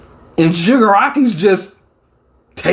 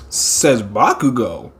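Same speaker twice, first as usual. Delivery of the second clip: ordinary talk, nothing marked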